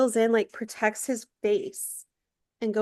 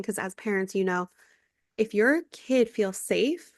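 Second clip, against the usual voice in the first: usual speaking voice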